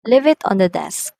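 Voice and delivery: firm start on "Leave", falling on "desk"; falling intonation. Shown as a demonstration. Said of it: "in commands or requests".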